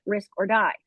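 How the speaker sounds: ordinary talk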